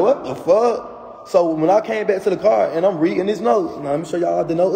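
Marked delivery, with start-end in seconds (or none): 0.0-0.9 shocked disbelief. Retold or imitated. Reading the boyfriend's note, high stunned outburst. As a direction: pitch jumps up in a stunned what the fuck.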